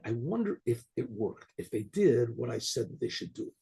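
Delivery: a hint of a sly villain musing; scheming bishop. Scheming bishop voiced in story.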